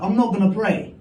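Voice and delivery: usual speaking voice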